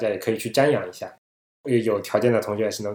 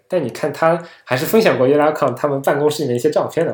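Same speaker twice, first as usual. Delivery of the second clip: normal talking voice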